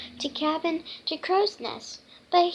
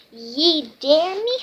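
high, loud indignant question; acted affronted bluster; challenged pirate; 4 semitones higher, 8 dB louder than usual. A narrator's reading. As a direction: throw the dare back like a storybook pirate. Pirate answers a dare.

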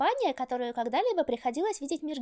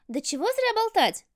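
impatient loud goddess; 7 semitones higher, 4 dB louder than usual. A narrator's reading. Artemis impatient, bangs shield.

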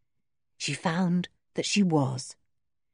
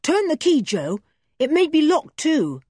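higher, louder call through the door; boy calling to his cousin; 8 semitones higher, 4 dB louder than usual. Acted. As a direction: call out an urgent tip. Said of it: "he called to Joe".

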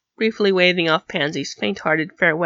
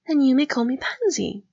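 lightly voiced hesitant girl, higher; higher, tentative offer; Pansy; 6 semitones higher than usual. A narrator's reading. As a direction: lift the voice, hold back slightly. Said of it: "she said, hesitating".

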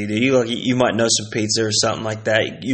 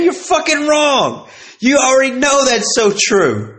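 worked-up accusing; 10 semitones higher than usual. Real, not acted. Calling out the listener.

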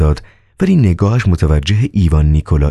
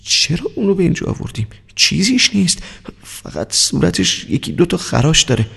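frightened thought; 10 semitones higher, 3 dB quieter than usual. Acted. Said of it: "با وحشت اندیشید".

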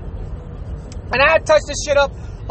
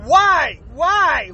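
usual speaking voice

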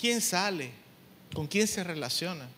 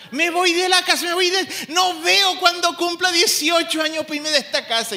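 put-on much higher, louder whiny teen voice; mocking sulky teenager; 10 semitones higher, 8 dB louder than usual. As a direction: parody the fed-up teenager talking back. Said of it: "¿Qué es lo que va a decir el joven?".

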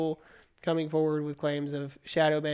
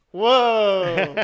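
louder, stretched-out whoa of amazement; drawn-out amazed whoa; 3 semitones higher, 8 dB louder than usual. Real, not acted. To co-host about the billionaire's loss, amazed whoa. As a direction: let the whoa hang in amazement. Reacting to Zuckerberg's huge loss.

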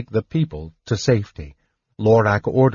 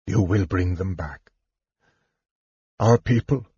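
a hint of a failing father; dying king; 2 semitones lower than usual. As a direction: ask it weakly, near the end. Dying king asks his daughter.

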